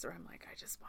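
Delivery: hushed, breathy aside; whispering. Retold or imitated. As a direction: drop to a whisper. Whispered aside about food fatigue.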